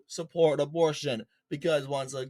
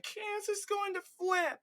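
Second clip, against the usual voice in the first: much higher, mock-squeaky parody; mocking quote; 6 dB quieter than usual. Vlogger mocking pundits who say Kansas will flip, high squeaky parody. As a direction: sneer it like a silly pundit. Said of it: "And they're saying".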